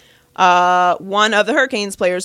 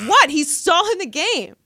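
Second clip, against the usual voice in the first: high, stretched-out indignant retort; retold heckler; 8 semitones higher than usual. Woman in the stands defending her yelling, mock indignant. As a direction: imitate an indignant heckler, drawing it out.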